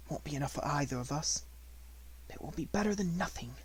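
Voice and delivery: soft, quiet inner musing; talking to herself. A narrator's reading. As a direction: murmur it sadly to herself. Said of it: "talking to herself, sick at heart".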